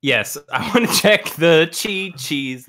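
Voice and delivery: ordinary delivery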